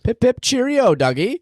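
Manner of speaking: put-on a jokey, terrible British accent aimed at the dog; bad British accent. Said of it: "That is the worst accent I've ever heard".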